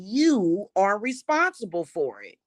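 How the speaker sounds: normal talking voice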